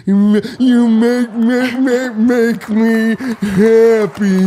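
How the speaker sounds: put-on stuttering childlike voice, stretched out; Simple Jack voice. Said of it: "Simple Jack".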